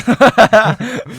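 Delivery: high, loud laugh out loud; real laughing fit; burst of laughter. Real, not acted. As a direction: laugh hard at the joke. Big laugh.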